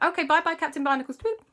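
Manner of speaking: put-on high, loud childish sing-song goodbye; kids' goodbye. Children saying bye to Captain Barnacles, high and loud. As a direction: chirp it like two small kids. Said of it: "Alexander and Crystal went".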